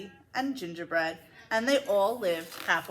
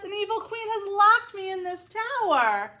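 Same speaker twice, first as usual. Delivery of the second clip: pleading princess; 11 semitones higher than usual. A narrator's reading. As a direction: beg the prince to rescue you. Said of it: "cried the princess".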